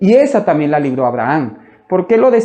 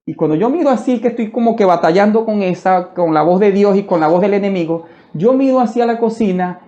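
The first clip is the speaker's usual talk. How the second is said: usual speaking voice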